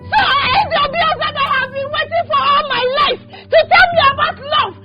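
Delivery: sobbing, shouting accusations through tears; tearful furious shout; crying angry outburst. Acted. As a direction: rage and grief spilling out together.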